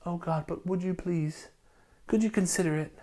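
a meek, overly polite prayer, softly; polite timid asking. Shown as a demonstration. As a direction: ask God meekly, barely above a murmur. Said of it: "He doesn't just go".

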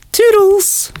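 very high, chirpy toodles; high put-on sing-out sign-off; silly falsetto. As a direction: squeal a dainty goodbye. Silly high sign-off, then retracted.

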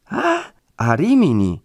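high, drawn-out echoed question; dismayed surprise. A narrator's reading. Woman learning the friar has gone far away, dismayed surprise. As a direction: stretch the "Ah", then question "Rimini" in disbelief.

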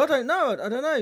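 high-pitched mock helplessness; imitated flustered worker. Paul answering the boss about his carpet, mock flustered. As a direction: plead ignorance in a high imitated voice. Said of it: "he goes".

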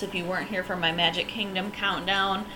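ordinary talk, nothing marked

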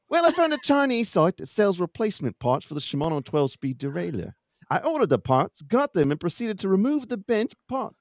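put-on improvised, sing-song Minnesota accent; winged Minnesota accent. Reading Brian's derailleur story to the hosts, made-up Minnesota accent. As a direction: read it in a badly faked Minnesota accent. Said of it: "I'm gonna wing an, an accent".

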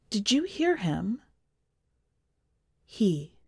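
usual speaking voice

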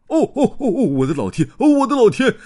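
high, loud delighted cries; overjoyed shout. Acted. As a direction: cheer as the telly lights up. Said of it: "激动地又大叫起来". (激动地高声欢呼)

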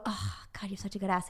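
very soft, low bedroom murmur; hushed and low, acted; murmured compliment. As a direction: imitate a lover murmuring praise. Acting a guy's bedroom compliment.

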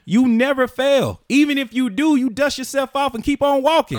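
loud emphatic yelling; rising to a shout to make a point. Real, not acted. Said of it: "Stop yelling at me".

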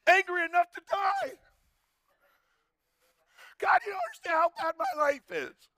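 high, whiny mock outburst; petulant retort. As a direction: wail it like a sulking teenager. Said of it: "he retorted".

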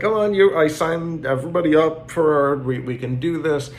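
high, loud coaxing of her parents; rally mom and dad to go vote; pushy daughter. Retold or imitated. Daughter urging her parents to vote, high and loud. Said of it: "It's like".

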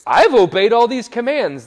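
loud, high proclamation; young man's boast. Acted. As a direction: burst out with a proud, booming claim. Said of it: "the guy replies with this".

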